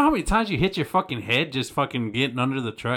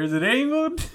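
mock squeak; 14 semitones higher than usual. Mimicking the quote, laughing.

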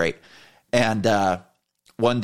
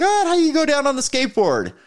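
much higher, mocking disbelief; imitated incredulous reaction; 4 dB louder than usual. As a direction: mimic a disbelieving friend, pitched way up. Imitates security chief's incredulous question.

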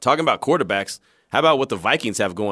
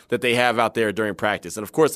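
ordinary talk

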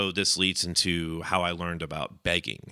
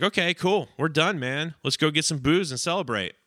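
higher-pitched acted cheer; mildly celebratory; 7 semitones higher than usual. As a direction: wrap it up gladly, pitch lifted. Acts partner celebrating begged money.

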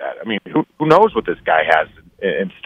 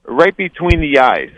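slow, higher mock accent, more Russian than French; failed French, sounding Russian; 5 semitones higher, slower than usual. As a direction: badly fake a French accent that comes out Russian. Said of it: "that last one was like, I sounded definitely Russian".

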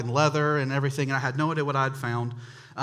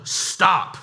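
dad's fed-up yell, loud and higher; retold yell; 5 semitones higher, 6 dB louder than usual. Dad fed up with brother dropping the washing machine, retold yell. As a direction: cut in with a sudden loud "stop". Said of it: "finally he just yelled".

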